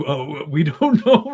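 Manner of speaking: slow, laughing shrug of a voice; lightly voiced Crown lawyer shrugging it off. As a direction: hands up, we don't know, through a laugh. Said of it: "the Crown's, like, putting up their hands".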